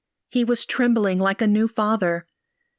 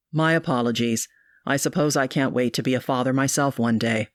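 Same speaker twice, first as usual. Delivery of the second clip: lower, apologetic suitor; Marcos's voice; 7 semitones lower than usual. A narrator's reading. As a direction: drop lower, embarrassed and sincere.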